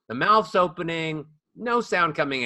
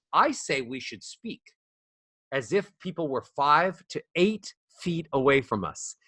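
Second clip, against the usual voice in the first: ordinary talk, nothing marked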